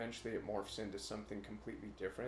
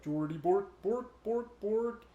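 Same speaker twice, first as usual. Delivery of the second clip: much higher, loud mock-Swedish gibberish; Swedish Chef; 11 semitones higher, 8 dB louder, slower than usual. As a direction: do a silly Muppet chef babbling bork. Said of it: "Swedish chef".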